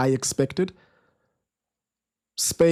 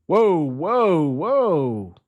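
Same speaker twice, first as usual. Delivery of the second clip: startled whoa; 3 semitones higher, much slower than usual. Real, not acted. Scuffle breaks out on pitch.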